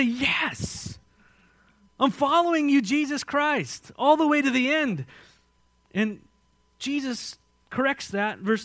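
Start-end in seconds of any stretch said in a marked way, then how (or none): 0.0-5.1 loud, high pledge of devotion; zealous declaration. Acted. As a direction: proclaim it slowly like a rallying vow. All voicing eager loyalty to Jesus.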